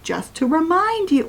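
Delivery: a high, fussy mocking reminder; lightly mocking prissy tone.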